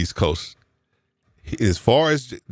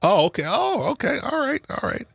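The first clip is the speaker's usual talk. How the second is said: way higher falsetto oh okay; mock surprise. Hearing the guest is only coming to Facebook, falsetto mock surprise. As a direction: play it up like it's shocking news.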